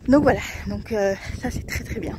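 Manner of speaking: breathless after physio; out of breath. Real, not acted. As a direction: talk while catching breath. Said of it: "je suis un peu essoufflée là".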